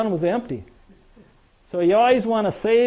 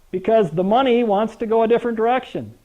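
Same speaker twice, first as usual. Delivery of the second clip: ordinary talk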